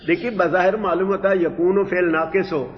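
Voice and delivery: ordinary talk, nothing marked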